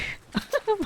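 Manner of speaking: high-pitched real giggle. Real, not acted. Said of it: "My mouth hurts from laughing".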